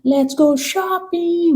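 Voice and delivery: put-on very high, slow valley girl "Let's go shopping". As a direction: squeal it like a spoiled rich girl. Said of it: "Lembra das branquelas falando".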